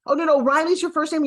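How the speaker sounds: mock a muddled new acquaintance fumbling a name; confused stranger. Imagined listener confused by her name.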